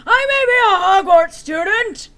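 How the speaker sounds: overdone furious boast; angry declaration. A narrator's reading. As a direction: announce it defiantly. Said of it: "Hagrid paused angrily".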